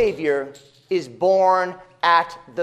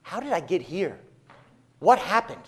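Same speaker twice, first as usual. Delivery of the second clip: hushed, lost murmur of someone waking confused; a hint of a dazed wanderer, quiet and puzzled; bewildered wanderer; 5 dB quieter than usual. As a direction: softly voice a drifting man asking himself where he is. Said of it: "waking up one day and saying".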